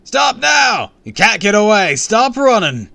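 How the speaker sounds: very loud, high orders; booming shouts. A narrator's reading. Guards close behind the runaway, booming shouts. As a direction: bellow at someone fleeing. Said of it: "Voices boomed close behind me".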